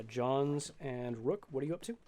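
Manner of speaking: normal talking voice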